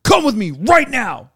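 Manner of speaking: loud, high commanding order; angry order. Retold or imitated. Officer dragging the traveler off, loud high order. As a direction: command him to come now. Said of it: "picks me up".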